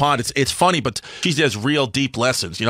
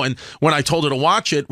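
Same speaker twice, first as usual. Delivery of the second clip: ordinary talk, nothing marked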